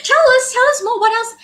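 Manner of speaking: high, loud, playful urging; eager excited. Real, not acted. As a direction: egg him on playfully. Host teasing, eager for more.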